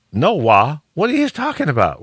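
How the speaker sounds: high-pitched incredulous questioning; acted bafflement. Host retells failing to understand his buddy, high comic bafflement. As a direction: echo it back totally lost, voice shooting up. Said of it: "I didn't understand it".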